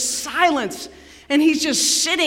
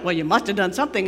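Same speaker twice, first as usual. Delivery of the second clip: rapid, lower-pitched mock argument; quick accusation; 6 semitones lower, 5 dB quieter, much faster than usual. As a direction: rattle off the friends' accusation. Friends accuse Job in acted debate.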